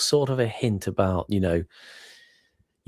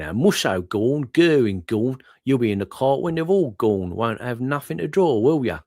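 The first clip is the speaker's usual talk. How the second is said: lightly put-on Cockney geezer accent; 5 semitones higher than usual. Reading the war cartoon caption on request, light Cockney accent. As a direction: read it as a cheeky Cockney soldier, a bit higher. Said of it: "read this in your best Cockney geezer accent".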